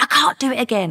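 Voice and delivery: high, loud exasperated quote; retold angry protest. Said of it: "he was getting angry".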